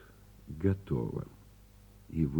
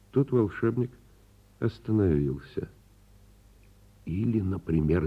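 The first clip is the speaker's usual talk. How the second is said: usual speaking voice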